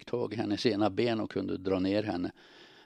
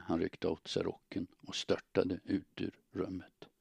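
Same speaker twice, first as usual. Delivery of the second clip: plain reading voice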